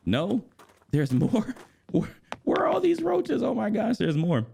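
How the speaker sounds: high, incredulous squeal with a laugh; half-laughing, high-pitched dismay; exasperated disbelief. Real, not acted. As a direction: let the pitch leap up in disbelief. Keeps finding more roaches in game.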